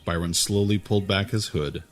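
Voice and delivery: ordinary delivery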